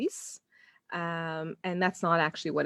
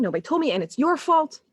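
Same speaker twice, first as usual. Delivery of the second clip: put-on childish tantrum; 7 semitones higher, 4 dB louder than usual. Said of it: "very mature reaction happening".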